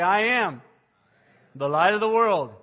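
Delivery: loud, bold declaration; call-and-response lead. Shown as a demonstration. Pastor leads church in repeating it, loud and bold. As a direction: belt it out for the room to echo.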